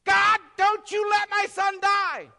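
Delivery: high, loud, slow angry shout; father shouting at God in a chapel; furious plea. Retold or imitated. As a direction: rage at God over the dying son. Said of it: "He got mad at God".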